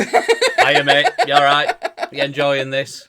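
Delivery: loud, high greeting through laughter; laughing mock greeting. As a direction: wave at him through a laugh. Greeting the cleaner watching later.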